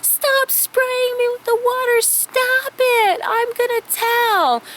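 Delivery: put-on very high, whiny kid yelling to stop; a little boy being sprayed and threatening to tell; shrieking little brother. Brother being sprayed by Kim with the hose, high and shrieking. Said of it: "He's like".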